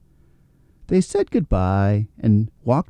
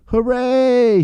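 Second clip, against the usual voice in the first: a high, happy cry of hooray; cheerful shout; 12 semitones higher, 3 dB louder than usual. Acted. Rabbit sees the water return, cheering out loud. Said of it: "shouted Robbie".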